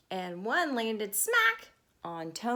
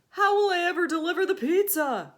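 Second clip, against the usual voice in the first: higher and louder dismayed groan; 7 semitones higher, 9 dB louder than usual. Acted. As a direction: wail it in dismay. Tony despairing.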